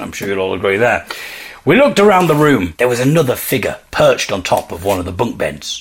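plain reading voice

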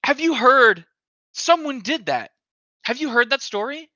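high eager questioning; excited. Real, not acted. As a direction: push the question, all eager. Eager to share a story.

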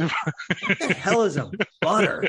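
high, laughing puzzled question; giggling, baffled by the misheard word; laughing disbelief. Real, not acted. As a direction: laugh through the confused question. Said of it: "I'm sitting there thinking".

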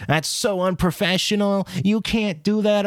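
high-pitched scandalized coworker imitation; mock flipping out. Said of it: "she flipped out".

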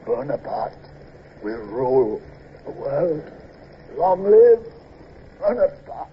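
halting last words, broken by pauses; dying gasps. Acted. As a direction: struggle from low murmur to defiant cheer. Dying man trying to speak.

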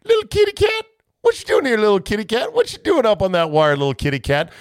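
put-on very high cooing baby talk; cooing to a kitty. Host mimicking the coach talking to the cat, very high coo. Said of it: "I told our players".

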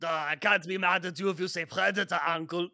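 put-on lightly Austrian-accented, fast Arnold; Schwarzenegger impression. Arnold forgiving friends in light Austrian accent. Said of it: "You really think that's how I talk".

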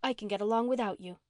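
usual speaking voice

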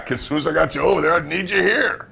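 words pushed out high through a laugh; boss laughing as he says it. Retold or imitated. Boss laughs while refusing the wrestler's request, high and loud. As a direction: chuckle through the line. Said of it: "And he's laughing".

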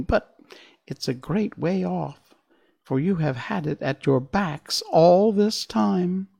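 high mouse queen; squeaky little voice. A narrator's reading. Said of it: "in her squeaky little voice".

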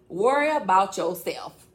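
loud, drawn-out comic telling-off; mock rebuke. Telling Toya off for fixing men, loud comic rebuke. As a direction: stretch it out loudly, about to laugh.

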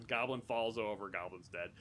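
usual speaking voice

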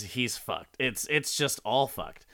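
ordinary talk

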